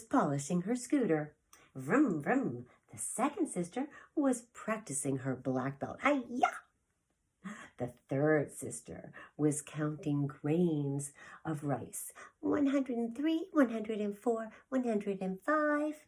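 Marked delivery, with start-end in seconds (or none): 1.8-2.7 vroom noise. Acted. Scooter noise imitated. 6.0-6.5 loud, high martial arts yell; karate shout. Acted. 12.4-16.0 higher, louder sing-song counting; counting sister. Acted. The third sister counting grains of rice, higher and brightly.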